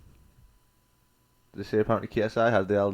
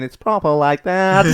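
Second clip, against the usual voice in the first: high, slow auto-tune drone; imitated robot voice; 7 semitones higher, 5 dB louder, slower than usual. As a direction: mimic auto-tuned talking, stretched and loud. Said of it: "like, robot voice".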